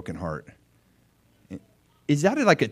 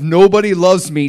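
mock despair; 6 semitones higher, 10 dB louder than usual. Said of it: "from the depths of".

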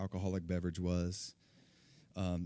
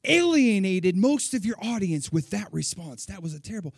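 angry scolding; 9 semitones higher, 12 dB louder than usual. Retold or imitated. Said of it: "and she goes".

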